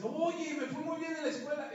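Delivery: high, loud, eager to share good news; excited child. Retold or imitated. Brother telling a sibling about school, excited.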